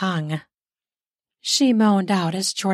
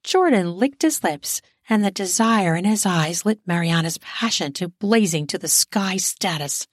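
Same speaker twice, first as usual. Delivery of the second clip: ordinary delivery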